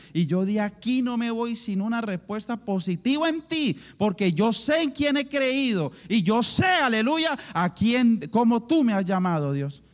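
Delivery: building to loud, high declaration; fervent prayer. Retold or imitated. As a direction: declare faith to God with rising force.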